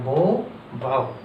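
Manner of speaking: slow बो, बाओ, the o then the ow; बो long o, बाओ gliding ow; contrast bo and bao. Shown as a demonstration. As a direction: set बो against बाओ clearly. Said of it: "बो नहीं पढ़ रहा है".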